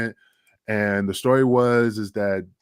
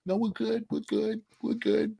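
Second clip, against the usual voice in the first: dismissive brush-off; 11 semitones higher, 6 dB quieter than usual. Retold or imitated. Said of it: "he's just like".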